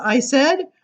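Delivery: lively sing-song verse reading; poem reading. Acted. Reading a funny poem to the crowd, sing-song. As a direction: bounce through the rhymes for listeners. Reads rhyming poem aloud.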